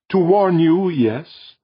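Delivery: high, measured voice of an alien being. Acted. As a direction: speak as a calm cosmic presence. Alien voice answers Jordan.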